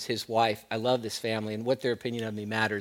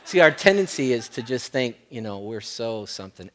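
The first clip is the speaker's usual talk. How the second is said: usual speaking voice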